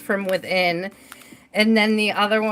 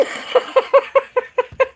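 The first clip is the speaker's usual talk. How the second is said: long high cackle; helpless high peals of laughter; real cry-laughing. Real, not acted. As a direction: laugh till the tears come. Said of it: "I'm cry laughing".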